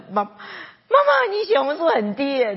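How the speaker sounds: high stretched call with a laugh. Retold or imitated. Pastor calling to his mother about her blood, high and laughing. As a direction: call mama, half laughing.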